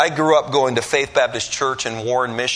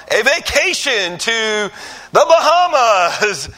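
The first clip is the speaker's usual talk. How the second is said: high, slow, loud prize reveal; slow high showroom announcer; 8 semitones higher, 5 dB louder, slower than usual. Retold or imitated. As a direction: announce the prize like a game show.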